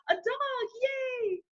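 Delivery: high squeal of joy; mock delight. Student hears host family has a dog, squealing with high joy. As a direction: cheer like a child getting a puppy. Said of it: "And I was like".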